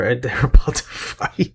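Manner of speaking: usual speaking voice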